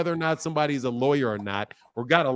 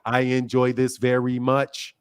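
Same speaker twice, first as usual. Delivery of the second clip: mocking robot monotone; 5 semitones lower than usual. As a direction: answer as a deliberate one-tone robot to mock the caller. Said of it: "You speak like a robot".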